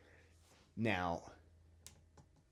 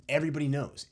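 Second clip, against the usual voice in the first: loud emphatic; 6 semitones higher, 7 dB louder, faster than usual. Real, not acted. Ranting about the powerful.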